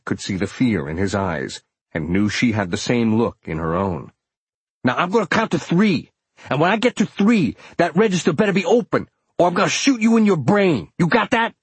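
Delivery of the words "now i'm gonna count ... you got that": loud, high-pitched threat at gunpoint; bully them with a forceful, raised voice; threatening robber. A narrator's reading. Armed robber ordering a cashier to open the register, threatening shout.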